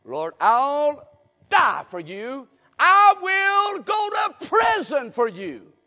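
loud, high, slow shouted vow; preacher voicing Peter shouting his loyalty; Peter's vow. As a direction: shout the pledge slow and high like a desperate disciple. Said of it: "And what did Peter say?".

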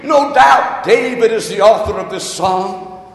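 loud, ringing preacher's cry, building; preaching at full force. Acted. Pastor rousing the congregation, loud preaching. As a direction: proclaim it to the whole church. Opening prayer and Scripture exaltation.

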